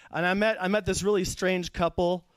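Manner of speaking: ordinary talk, nothing marked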